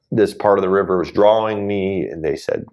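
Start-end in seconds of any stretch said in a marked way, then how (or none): none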